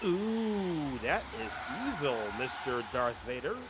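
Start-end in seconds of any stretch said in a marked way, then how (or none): none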